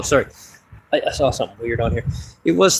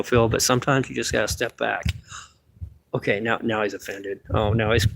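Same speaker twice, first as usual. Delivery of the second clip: usual speaking voice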